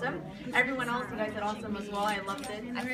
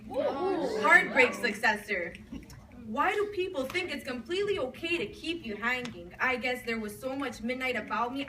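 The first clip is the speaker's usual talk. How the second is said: earnest slam-poetry recital with rhythmic phrasing; spoken-word poem. Acted. Poet to an audience about lost long-distance love, spoken-word recital. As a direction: recite it in flowing runs, pausing between images.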